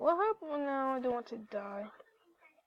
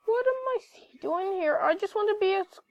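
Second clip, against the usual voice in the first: alarmed; 7 semitones higher, 4 dB louder than usual. Acted. Repeats louder, higher.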